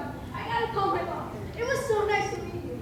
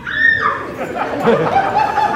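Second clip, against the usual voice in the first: high shriek melting into breathless laughter; delighted squeal then laugh; joyful laughing shriek; 14 semitones higher, 14 dB louder than usual. Acted. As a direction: let the joy burst out as laughter. Joyful outburst after news.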